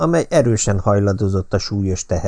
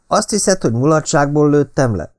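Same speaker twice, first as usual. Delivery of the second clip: angry outburst; 7 semitones higher than usual. A narrator's reading. Narrator bursting out angrily at Patricia. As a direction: snap back, stung by the accusation. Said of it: "fakadtam ki dühösen".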